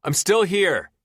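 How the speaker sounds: man shouts back to the trapped woman; shouting. A narrator's reading. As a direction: shout toward her through the doors. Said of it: "I shout toward her".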